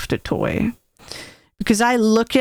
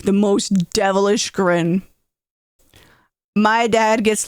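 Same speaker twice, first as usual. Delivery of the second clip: ordinary delivery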